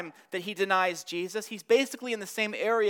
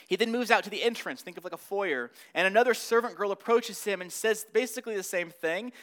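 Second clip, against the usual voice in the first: normal talking voice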